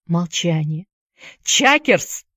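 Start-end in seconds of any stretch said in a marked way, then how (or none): 1.4-2.2 higher, insistent call. A narrator's reading. Boy calling unresponsive friend, insistent cry. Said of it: "снова крикнул Джаред".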